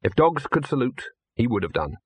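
ordinary delivery, nothing marked

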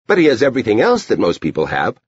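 Edie. A narrator's reading. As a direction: hurry it out like a knowing child. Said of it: "Edie said".

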